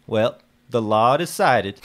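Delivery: loud, slow and heavy; grim declaration. Acted. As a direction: hammer each word down slowly. Lucky justifies the killing.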